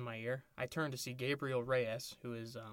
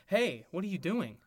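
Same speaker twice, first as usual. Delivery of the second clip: startled protest; 6 semitones higher, 7 dB louder than usual. Acted. Fanfic hero protests the kiss.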